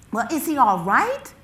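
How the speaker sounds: urgent plea for reassurance; anxious question. Acted. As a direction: burst out, desperate to hear he's okay. Mother anxiously asks officer.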